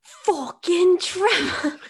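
hammed-up British curse; bad British accent. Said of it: "you have to do it in his accent".

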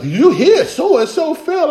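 gossiping. Retold or imitated. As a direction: savor the scandal. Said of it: "People come around like".

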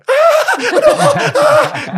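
a big high burst of laughter; loud laugh. Real, not acted. Laughs at own panic.

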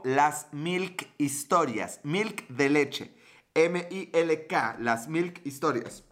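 slow, spaced-out channel name; slow spelling out. Real, not acted. Telling a viewer where to follow on YouTube, slow and deliberate. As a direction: say it slowly, letter by letter for M I L K.

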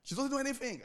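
mock-indignant relative complaining; mimicking a complaining relative. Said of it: "He said, he said".

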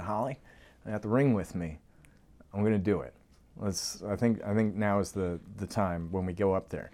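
low, soft, secretive voice; confiding aside. Retold or imitated. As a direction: take someone aside and share the plan quietly.

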